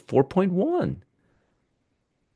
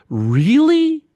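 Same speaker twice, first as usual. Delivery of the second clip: sarcastic really; 14 semitones higher, 4 dB louder than usual. Real, not acted. Sarcastic reply to Fed minutes.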